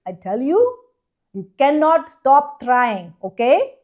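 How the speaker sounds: loud, high, hammed-up Indian accent; imitation Indian accent. Shown as a demonstration. Co-host addressing everybody for the public, loud put-on Indian accent. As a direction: exhort the crowd in a mock Indian accent. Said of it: "that good of an Indian accent".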